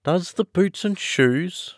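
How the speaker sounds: high, slow, puzzled question; wondering Alice, drawn out and rising in pitch. Acted. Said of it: "repeated in a wondering tone".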